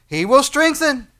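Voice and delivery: slow, loud, high declaration; emphatic assurance. Acted. Preacher answering doubt of God's strength, emphatic assurance. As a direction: proclaim it deliberately at full force.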